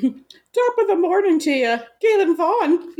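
high, slow stage-Irish lilt; chirpy mock leprechaun greeting; Irish accent.

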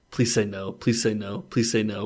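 ordinary delivery

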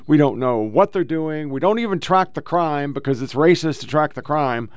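ordinary delivery

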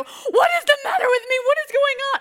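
loud, high, tearful wail; mock-sobbing down the phone to mom; crying to mom. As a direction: cry it out high and loud, bewildered. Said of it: "call my own mom and go".

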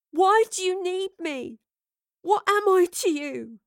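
high, slow, anguished questioning; distraught demand. A narrator's reading. Astrid turning on Lorelei again, distraught and demanding. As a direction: plead for answers, voice pitched high.

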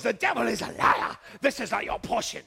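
a hint of a Sierra Leonean preacher, loud on devil; lightly voiced friend rebuking the devil in a Sierra Leone accent; a friend praying over the pastor, loud. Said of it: "He was from Sierra Leone".

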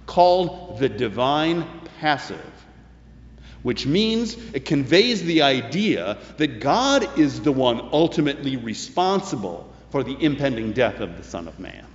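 usual speaking voice

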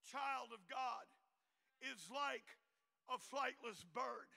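usual speaking voice

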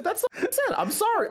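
very high, laughing apology; defensive squeak. Real, not acted. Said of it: "Screaming O J".

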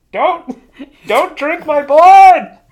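high, very loud cartoon scream; hammed-up shrieking plea; Oscar the Grouch screaming. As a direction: scream it in mock terror, dragging out the words. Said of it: "Oscar the Grouch recedes into his trash can, screaming".

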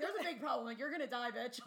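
hammed-up warning, laughing; mock alarm. Mocking rom-com heroines falling in manholes, loud laughing alarm. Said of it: "they're like".

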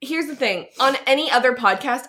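normal talking voice

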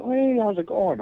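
lightly voiced cheerful nurse; imitating nurse. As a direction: play the nurse, surprised and upbeat.

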